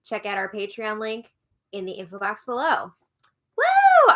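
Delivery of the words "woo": high, loud cheer; excited whoop. Real, not acted. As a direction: whoop with joy. Cheering the Patreon supporters.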